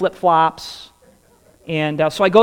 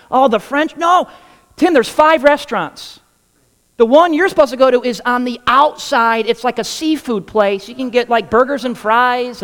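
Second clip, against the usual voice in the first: excited brother; 7 semitones higher, 5 dB louder than usual. Retold or imitated. Brother explains on phone.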